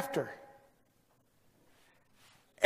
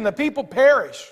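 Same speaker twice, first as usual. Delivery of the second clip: ordinary delivery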